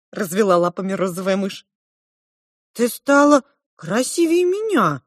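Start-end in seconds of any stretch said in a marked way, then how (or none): 2.7-5.0 high, wistful admission; sighing admission. A narrator's reading. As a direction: confess it with a sigh. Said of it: "со вздохом призналась Маринка". (признание со вздохом)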